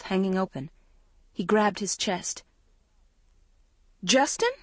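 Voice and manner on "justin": high, alarmed call of his name; shrill cry. A narrator's reading. Girlfriend crying out as Justin collapses, shrill and alarmed. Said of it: "Maggie cried shrilly".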